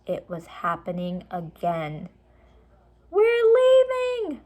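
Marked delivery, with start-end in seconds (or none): 3.1-4.4 very high, loud, slow cry; girl crying out in excitement; excited cry. A narrator's reading. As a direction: cry out as it starts. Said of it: "cried Annie".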